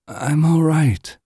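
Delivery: high, slow, weakly insisting she's fine; wounded woman. Acted. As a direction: reassure them despite the wound.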